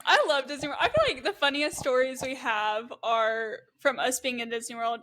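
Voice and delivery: ordinary talk, nothing marked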